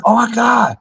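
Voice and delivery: very high imitation of a fan's squeal; mock starstruck fan. Friends pretend to spot a celebrity, mock excited squeal. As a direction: fake being starstruck, voice shooting up.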